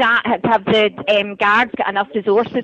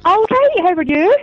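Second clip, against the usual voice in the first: Glasgow accent; 6 semitones higher, 7 dB louder than usual. Real, not acted. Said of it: "that's a lovely Glaswegian voice".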